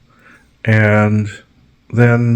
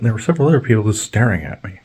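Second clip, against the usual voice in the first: normal talking voice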